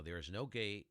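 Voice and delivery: ordinary talk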